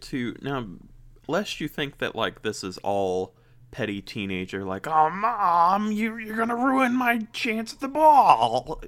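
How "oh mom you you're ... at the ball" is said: put-on high, loud whiny teen girl; teenage girl voice. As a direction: whine it like a sulky teenager. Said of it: "there's my teenage girl voice".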